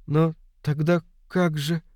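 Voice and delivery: high, halting, uncertain question; bewildered faltering. Acted. Boy trails off, confused about the girl, faltering high voice. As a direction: falter as the realization hits.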